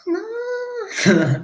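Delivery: very high, soft wail; failed voice attempt. Streamer trying the singer's voice, very high wail. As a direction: attempt a thin falsetto note. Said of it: "I cannot do that voice".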